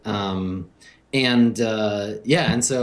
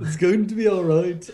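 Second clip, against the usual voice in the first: put-on British accent; 7 semitones higher than usual. Joining the others talking like Beatles, British accent. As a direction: chime in with a mock British accent.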